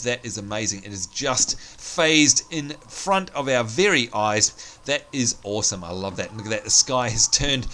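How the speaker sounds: ordinary delivery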